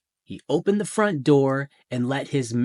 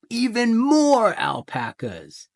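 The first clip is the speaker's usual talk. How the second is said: gleeful wonder; 10 semitones higher, 5 dB louder than usual. Acted. Greedy wondering llama.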